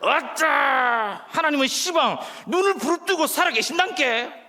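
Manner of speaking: loud, high Jeolla proclamation; exuberant, exaggerated Jeolla accent; Jeolla dialect. Shown as a demonstration. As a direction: holler it in broad Jeolla dialect, stretching the opener. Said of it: "전라도 버전을 제가".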